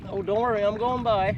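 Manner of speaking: high, loud call to the dog; called out loud to a barking dog. Real, not acted. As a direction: reassure the dog from a distance.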